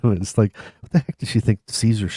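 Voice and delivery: usual speaking voice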